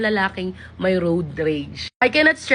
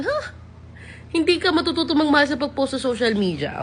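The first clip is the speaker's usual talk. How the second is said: incredulous; 6 semitones higher than usual. Real, not acted. Incredulous rebuttal.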